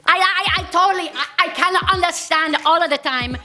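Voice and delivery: shouting Spanish woman. Acted. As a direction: rant like a furious Spanish wife. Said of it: "why do Spanish ladies sound so crazy when they're shouting?".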